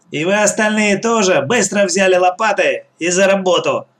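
commanding. A narrator's reading. Gang boss sends the others to work, commanding. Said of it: "скомандовала Черногорская".

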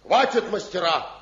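stern call to stop. Acted. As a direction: cut the quarrel short with authority. Elder stops the masters' quarrel. (строгий окрик)

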